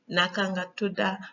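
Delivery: Gambian Wolof, "tuda" with final "ah"; Gambian accent. Shown as a demonstration. Teacher contrasting the two accents for learners, Gambian accent. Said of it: "Senegalese accent and Gambian accent".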